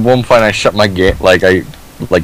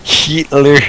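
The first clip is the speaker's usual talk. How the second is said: high-pitched imitation of a movie German accent; mock German accent; 6 semitones higher than usual. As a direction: exaggerate the German accent on "Hitler". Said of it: "that's how the one guy said it".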